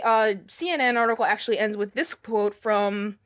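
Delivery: usual speaking voice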